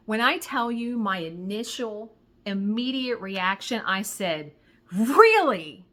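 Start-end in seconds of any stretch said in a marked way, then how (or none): 4.9-5.9 loud, drawn-out really?; stunned, loud really; flat disbelieving really. Retold or imitated. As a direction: say it like you can't believe it. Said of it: "I said it just like that".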